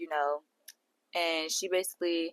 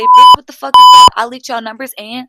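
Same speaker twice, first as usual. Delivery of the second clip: sudden screaming cuss-out, far higher and louder; mock cussing out; 3 semitones higher, 21 dB louder than usual. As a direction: blow up at them out of nowhere.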